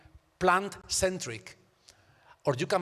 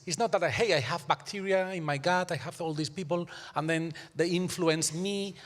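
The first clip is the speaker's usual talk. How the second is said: normal talking voice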